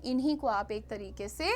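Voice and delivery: ordinary delivery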